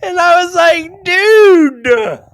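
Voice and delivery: real helpless laughter shouting dude; laughing shout. Real, not acted. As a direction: burst out laughing as you yell it. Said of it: "I was laughing so hard".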